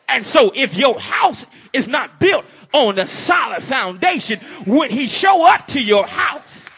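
loud, high, shouted sermon; fiery preacher; preaching at full force. Acted. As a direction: drive the message home to the congregation.

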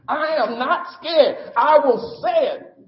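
high, loud, slow defiant cry; emotional declaration. Real, not acted. Preacher defiantly tells the church he is not scared, crying out. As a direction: stand up and proclaim it with real feeling. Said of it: "I get emotional".

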